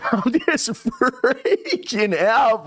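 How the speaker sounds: high, drawn-out mock panic; freaking out. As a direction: stretch it into a laughing, panicked squeal. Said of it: "when I started freaking out".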